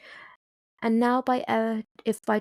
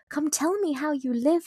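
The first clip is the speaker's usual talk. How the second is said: crying out; 6 semitones higher than usual. A narrator's reading. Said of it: "I cried".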